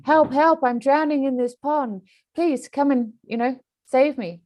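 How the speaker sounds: mock drowning victim begging to be saved; drowning cry for help. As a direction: call for help from the water, high. Drowning person calling for help.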